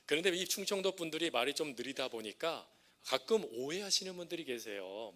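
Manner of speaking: usual speaking voice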